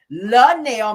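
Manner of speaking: loud, drawn-out mocking name; deliberately wrong name, sneered; with an attitude. [name] needling Nell with the wrong name, snide. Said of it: "With an attitude".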